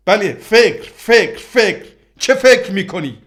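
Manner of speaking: an angry outburst that drags out the word فکر; furious shout. Acted. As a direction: yell it at them in exasperation. Quarrel peaks, repeated demand.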